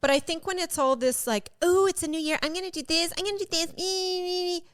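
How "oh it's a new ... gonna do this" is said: higher, softer mock enthusiasm; put-on eager sing-song of empty promises; mocking resolution-maker. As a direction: mimic someone gushing over resolutions. Mocking New Year resolution makers.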